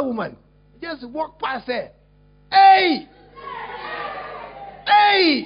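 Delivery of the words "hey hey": sharp shout, much louder; loud startled shouts. Acted. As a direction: shout out to stop them. Shouting to wake collapsed woman.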